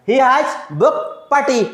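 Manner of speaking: every word spaced out for copying; slow, clear teacher dictation. Shown as a demonstration. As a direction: read it slowly so students can write. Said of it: "मैंने क्या कर दिया?".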